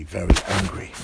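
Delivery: loud punch on very angry; angry princess. Acted. Princess recalls the witch.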